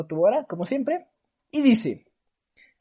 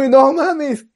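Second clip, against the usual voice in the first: higher, louder frightened gasp; scared exclaim; 6 semitones higher, 8 dB louder than usual. Retold or imitated. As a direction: blurt it in fear. Kid terrified reaction.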